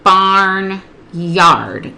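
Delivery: BARNYARD drawn out as BARN ... YARD; split into syllables. Shown as a demonstration. Tutor answering as the student would, BARN and YARD slowly apart. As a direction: say "barnyard" slowly in two separate beats. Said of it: "What are the syllables in barnyard?".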